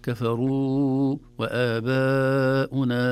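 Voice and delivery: وآباؤنا mit langen Dehnungen, sehr langsam; gedehnte Madd-Laute. Shown as a demonstration. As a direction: zieh jedes Madd in وآباؤنا voll aus. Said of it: "هذه تحتاج إلى عناية".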